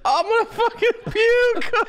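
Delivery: high, slow, disgusted wail; grossed-out outburst. Real, not acted. Co-host reacts to booger-eating confession, high grossed-out wail. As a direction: wail it out, revolted and amused.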